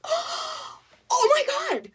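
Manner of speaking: very high, loud amazed squeal; amazed diner. Retold or imitated. Diner gushing to waitress about her accent, high squeal. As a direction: gush like she's blown away. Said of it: "they would be like".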